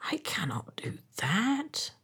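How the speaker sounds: quiet acted sorrow; tearful refusal. A narrator's reading. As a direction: refuse as if about to weep. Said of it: "he began to weep".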